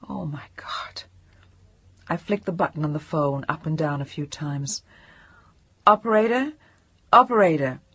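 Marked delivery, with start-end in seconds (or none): 0.1-1.0 breathless whisper of shock; the words barely leave her; hushed stunned whisper; horrified whisper. Acted. Shock at saying it. 5.9-7.8 louder, higher urgent calls; anxious raised calls; urgent calling. Acted. Calling out on the line.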